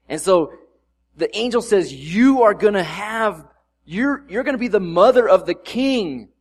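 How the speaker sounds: loud, high, fervent preaching; preaching at full force. Acted. Pastor proclaiming the coming king to the church, at full force. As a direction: build the sermon to its peak.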